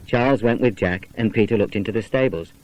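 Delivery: ordinary delivery, nothing marked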